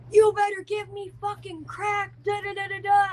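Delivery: high, mock yell demanding crack; imitated screaming girl. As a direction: ham up a strung-out girl yelling. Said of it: "this girl screaming downstairs".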